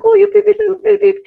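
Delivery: high, loud delighted coo; cooing at a baby. Retold or imitated. Cooing at a crying baby in the mall, high loud coo. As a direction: gush over a crying baby. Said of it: "and I was like".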